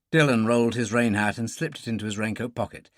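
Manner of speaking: plain reading voice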